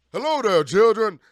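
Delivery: Chef impression. Fan auditioning for Chef, loud booming greeting. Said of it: "hire me for chef".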